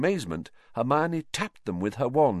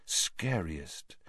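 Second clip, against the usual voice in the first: soft echo; 6 semitones lower, 10 dB quieter than usual. Acted. Echoing word.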